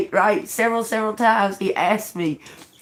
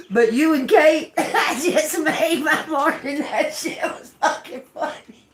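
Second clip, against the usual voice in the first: can't help laughing; 5 semitones higher than usual. Real, not acted. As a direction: voice rising, then lost in laughter. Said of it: "I can't help it".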